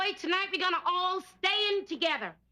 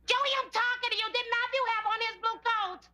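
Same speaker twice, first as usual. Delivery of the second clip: impatient; 6 semitones higher than usual. Acted. Mother losing patience with son.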